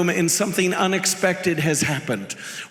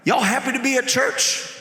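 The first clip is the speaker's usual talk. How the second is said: higher, jokey provocation with a spat-out pah; mock taunt, pitched up; mock antagonizing; 4 semitones higher than usual. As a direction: playfully needle the congregation. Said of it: "I'm here to tick 'em all off".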